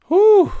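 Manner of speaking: high-pitched wired "whew"; wired whoop. Acted. Streamer showing viewers how wired they get, high excited whew. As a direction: let out a high, keyed-up whoop. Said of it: "I get really freaking wired, and I'm like".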